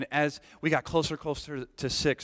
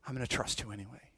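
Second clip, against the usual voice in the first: softly, quickly, quietly trusting; hushed declaration of trust; quiet resolve; 3 semitones lower, 10 dB quieter, faster than usual. Retold or imitated. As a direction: say it under your breath, quickly. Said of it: "to say".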